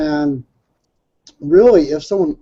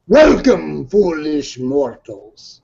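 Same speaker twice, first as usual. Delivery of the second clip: dark lord; much slower than usual. Retold or imitated. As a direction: drag out each word, ominous and hollow. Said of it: "Just like that".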